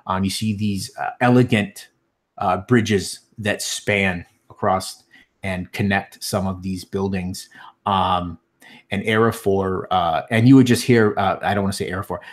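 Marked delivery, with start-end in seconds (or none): none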